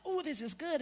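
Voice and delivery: high delighted tasting; pleasantly surprised taster. Retold or imitated. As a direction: sound surprised by the cupcake. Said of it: "they were like".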